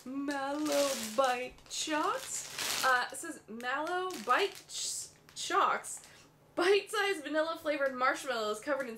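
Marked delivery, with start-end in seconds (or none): 0.1-2.8 drawn-out, deliberate syllables; slowly sounding out an odd product name; super slow reading. Shown as a demonstration. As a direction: stretch every word out slowly. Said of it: "I'm reading it super slow".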